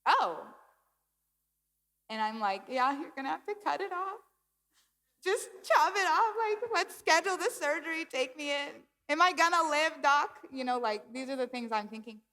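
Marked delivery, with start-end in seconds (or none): 0.1-0.5 louder, higher "oh"; doctor's reaction. Retold or imitated. Doctor looking at her foot, mildly acted surprise. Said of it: "he looked at my foot and he's like". 2.7-10.4 high, louder pleading that builds; mock panic. Patient begging the doctor to amputate, hammed-up high panic.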